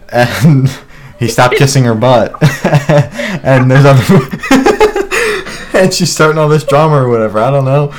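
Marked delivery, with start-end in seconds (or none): 2.4-7.4 laughing. Real, not acted. Laughing while telling drama.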